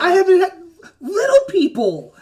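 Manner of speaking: high, loud, delighted giant; booming giant. Acted. Cloud giant thrilled by his little guests, high and booming. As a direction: boom it out high-pitched and overjoyed. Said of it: "a little higher pitched than you expected".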